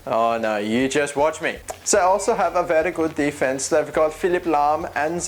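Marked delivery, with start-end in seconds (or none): 1.9-5.2 hammed-up German accent; put-on comic German voice. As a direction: try to hold the bad German accent. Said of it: "I'm not gonna talk like that anymore".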